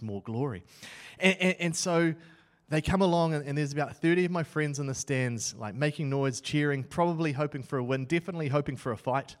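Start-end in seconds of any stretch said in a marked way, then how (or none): none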